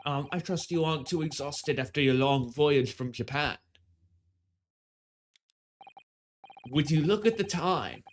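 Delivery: put-on a light pretentious British accent. British official greeting the travelers, in a light British accent. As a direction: play the polite British gentleman, lightly. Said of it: "really pretentious British voice".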